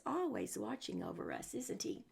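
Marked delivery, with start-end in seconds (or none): none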